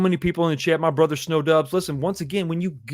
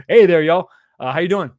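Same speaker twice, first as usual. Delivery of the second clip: 1 hearty greeting; 2 semitones higher, 10 dB louder than usual. Pretend. Reads viewer greeting in voice.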